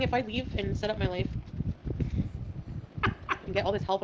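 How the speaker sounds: ordinary delivery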